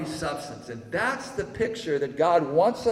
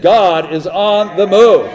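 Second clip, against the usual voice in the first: louder, higher rallying cry; preacher's ringing, raised-voice repeat; emphatic repeat; 4 semitones higher, 16 dB louder than usual. Real, not acted. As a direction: proclaim it loud to rouse the congregation. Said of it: "I said".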